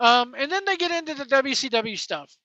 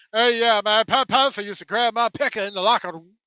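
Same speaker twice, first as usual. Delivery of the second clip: Tony Atlas mumbling impression; 3 dB louder than usual. Host mimics Tony Atlas's mumbled interview in a put-on voice. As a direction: garble the words like marbles in the mouth. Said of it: "marbles in his country ass".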